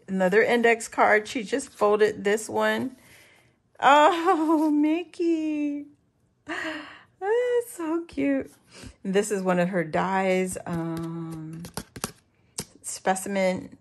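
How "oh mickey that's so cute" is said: high, drawn-out gushing; melting with delight. Real, not acted. Crafter spotting Mickey on the card, melting with delight.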